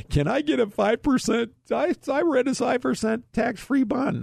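high-pitched, put-on pleading caller voice; squeaky wheedling impression of a caller; mock pestering caller. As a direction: mimic an annoying client way up high. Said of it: "they're gonna call just to try to aggravate me".

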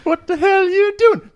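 very high, hammed-up "What the hell are you doing?"; mock line. Friend mocks the sound bite in a high squeal. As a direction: squeak it out as a joke. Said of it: "Just cut our names into it".

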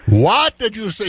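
Irish bartender taking offence, lightly voiced; Irish accent. Angry Irish bartender questions a tourist's order, light Irish accent. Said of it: "in an Irish accent".

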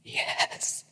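barely audible whispered yes; whispering. Acted. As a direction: whisper it softly. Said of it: "he whispered".

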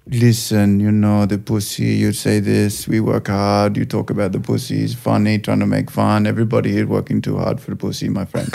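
put-on measured, subdued Indian-accented telling-off; imitated Indian accent. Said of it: "stuck in the accent too".

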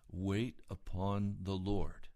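slow, leaning on wait; speaking to himself at dawn, slow and firm; slow self-exhortation. Retold or imitated. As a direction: remind yourself slowly to wait. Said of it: "And I said".

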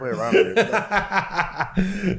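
slow, high, laughing through the words. Real, not acted. Repeating his own line to the hosts, laughing. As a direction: repeat it while cracking up.

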